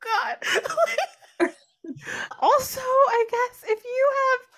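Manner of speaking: high wheezing giggles through words; laughing helplessly, breath squeaking; wheezy laugh. Real, not acted. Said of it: "You sound like you're wheezing".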